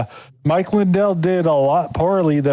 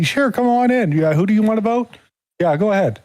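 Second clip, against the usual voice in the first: lightly voiced, higher pitched poll worker waving voters through; mock poll worker; 7 semitones higher than usual. Mocking poll workers letting voters in, breezy and higher. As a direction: play a breezy poll worker who lets anyone in.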